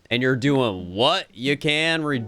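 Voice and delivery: put-on Southern accent, down-home and friendly; Southern Gyarados. Gyarados waking and befriending a young'un, Southern accent. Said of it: "why they made Gyarados Southern".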